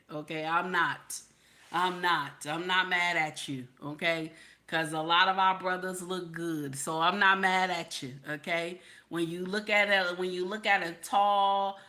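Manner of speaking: ordinary talk, nothing marked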